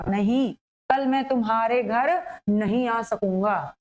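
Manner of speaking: slow, with the first नहीं set apart by a pause; pause after नहीं. Shown as a demonstration. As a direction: stop after the opening नहीं, then go on deliberately. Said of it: "यहाँ पर होगा एक रुका हुआ". (पहले नहीं के बाद रुककर)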